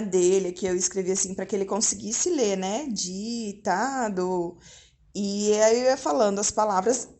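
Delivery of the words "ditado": 1 slow read-out. Shown as a demonstration. Reading the dictation title aloud.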